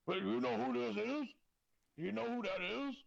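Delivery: playful hype-man to the hostess; mock hype-man voice. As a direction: talk me up like a celebrity's buddy. Shaker bragging to hostess, voiced.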